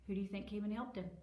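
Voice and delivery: usual speaking voice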